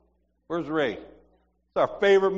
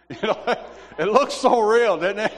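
laughing, pitched up and loud; 5 semitones higher, 6 dB louder than usual. Real, not acted. Preacher recalling how real the movie clip looked, laughing. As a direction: talk through a burst of amusement.

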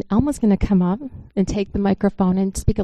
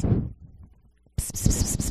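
soft unvoiced hissing pss; enemy whispering hiss; 6 dB quieter, much slower than usual. Enemies circling the chair, hissing lies in whispers. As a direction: hiss it like a sly tempter in the ear. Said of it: "whisper and tell them".

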